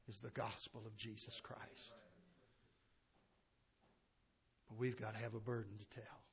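very soft, low pulpit plea; quiet, grave appeal with long pauses; hushed preaching appeal. Real, not acted. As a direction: bring it right down for the congregation.

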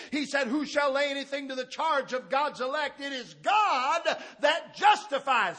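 high, loud pulpit preaching; ringing out like a fired-up preacher; full-force preaching. Real, not acted. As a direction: preach it hard to the congregation.

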